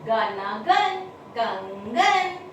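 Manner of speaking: Kangan drawn out syllable by syllable; slow sounding out. Shown as a demonstration. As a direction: build Kangan slowly.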